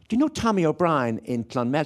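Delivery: put-on slipping into a small-town Irish accent; changed home accent. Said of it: "your accent, everything about you".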